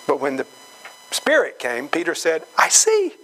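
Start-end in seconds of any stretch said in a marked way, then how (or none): 2.6-3.2 high-pitched dawning amazement; light-bulb moment, voice leaping way up; sudden realization. Acted. Peter when the Spirit came, suddenly getting it.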